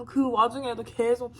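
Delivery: normal talking voice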